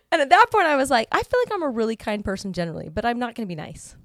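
telling a friend she will not be nice; fired up. Retold or imitated. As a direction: declare it with heat. Animated retelling of resolve.

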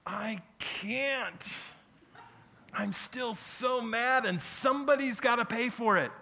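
high, loud, slow imitated rage; mock fury. Pastor mimicking grudge-holding members, hammed-up rage. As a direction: ham up the grudge holder's anger. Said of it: "some of you are going".

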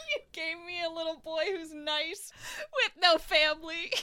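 laughing. Real, not acted. Mock lament while laughing.

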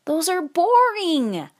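put-on high, loud, drawn-out kid complaint; stretched high-pitched protest; childish whine. A narrator's reading.

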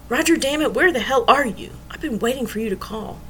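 upset friend snapping down the phone; exasperated. A narrator's reading.

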